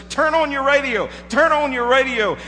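high, loud urgent calling out; frantic alarm to the churchgoers. Acted. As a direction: burst in and call it out to the room. Man runs in calling out.

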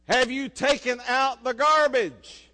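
a loud, high, slow scolding question; stern demand. Retold or imitated. Mother confronting her son about the garbage, scolding loudly. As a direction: demand an answer like a fed-up mom.

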